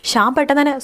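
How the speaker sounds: ordinary delivery, nothing marked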